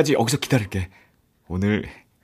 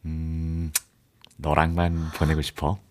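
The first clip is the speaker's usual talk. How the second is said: tender; 7 semitones lower, 5 dB quieter than usual. Acted. Romantic confession.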